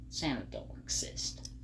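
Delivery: hushed whispered reveal. Retold or imitated. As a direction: whisper it like a secret. Dramatic reveal to a child.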